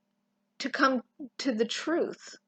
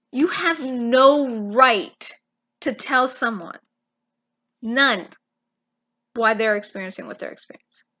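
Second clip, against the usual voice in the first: louder, insistent "you have no right"; forceful rebuke; 6 dB louder than usual. Real, not acted. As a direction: hammer home that they have no right. Preacher rebuking the congregation.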